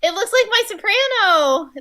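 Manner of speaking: high, loud delighted squeal; excited gasp. Real, not acted. Sees new saxophone.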